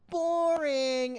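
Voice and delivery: high mocking yell of a kid; kid yelling boring. Imitating a rude kid yelling at the movie screen. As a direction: squeal it like a bratty kid.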